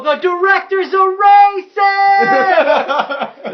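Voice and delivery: high, drawn-out full shout; yelling out. Acted. Player's character yells an accusation to raise a mob, shouting. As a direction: holler it to stir up a crowd. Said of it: "I yell out and I go".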